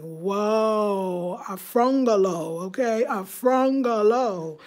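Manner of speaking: slow, drawn-out savoring of the coined word; playful mock awe. Imagining viewers seeing her African bungalow, playfully awed. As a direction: stretch it out as a pretend wowed reaction.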